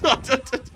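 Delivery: loud, high delighted laugh; high burst of laughter. Real, not acted. Streamer laughing at music-playing drone clip, delighted. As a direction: crack up at the clip.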